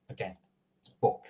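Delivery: ordinary talk, nothing marked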